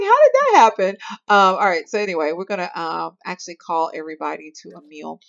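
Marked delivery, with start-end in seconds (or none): 0.0-0.9 mock surprise. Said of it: "I'm like".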